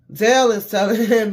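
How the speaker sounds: breaking into a loud laugh mid-line; real laugh while telling it. Real, not acted. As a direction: laugh out loud through the words. Laughs mid-sentence while recapping.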